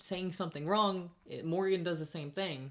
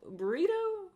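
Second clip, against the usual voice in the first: questioning burrito; slower than usual. Retold or imitated. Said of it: "I was like".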